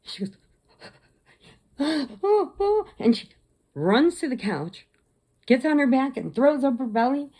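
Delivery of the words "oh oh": gasp, then high excited little hoots; imitated animal noises. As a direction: mimic a pet gasping and cooing, high. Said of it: "Monkey starts going. She goes".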